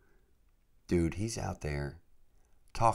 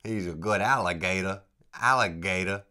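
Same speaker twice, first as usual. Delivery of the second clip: Louisiana accent attempt; 3 semitones higher, 5 dB louder than usual. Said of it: "I suck".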